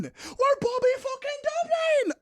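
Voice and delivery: very high excited yell; high-pitched team chant; hyped shout. Real, not acted. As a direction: scream the team name proudly.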